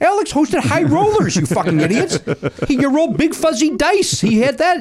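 high-pitched, incredulous comic rant; worked-up, high-voiced outburst; exasperated rant. Real, not acted. Heated rant about a game-show host.

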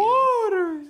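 very high imitated falsetto cry; falsetto scream. Comedian trying to copy the water screamer, high falsetto. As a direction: try a long falsetto shriek. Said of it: "one of you guys wants to do it".

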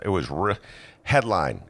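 usual speaking voice

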